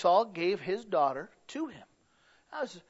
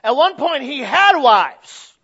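loud emphatic preaching; 8 semitones higher, 15 dB louder, slower than usual. Acted. Repeated line, second louder.

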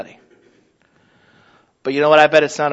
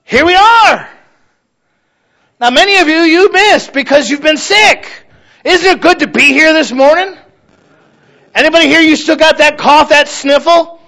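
louder and higher, bursting with gratitude; fervent preaching; 9 semitones higher, 5 dB louder than usual. Acted. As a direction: preach it out, almost crowing with thanks. Preaching with full force.

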